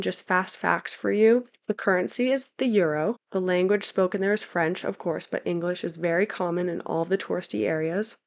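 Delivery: ordinary delivery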